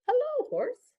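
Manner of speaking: very high squeaky duck greeting. Acted. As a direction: chirp the hello way up high. Duck greets farm animals.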